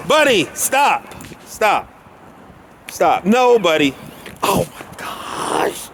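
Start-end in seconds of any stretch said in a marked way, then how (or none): 4.4-5.9 breathy unvoiced oh my gosh, slowed right down; exasperated whisper; whispered dismay. Real, not acted. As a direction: gasp it under your breath. Dog runs at German Shepherd.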